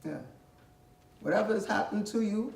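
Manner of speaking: ordinary talk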